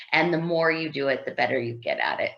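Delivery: ordinary talk, nothing marked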